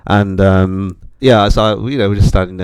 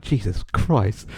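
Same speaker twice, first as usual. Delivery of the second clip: awed mutter; 10 dB quieter, much slower than usual. Retold or imitated. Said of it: "looking around like".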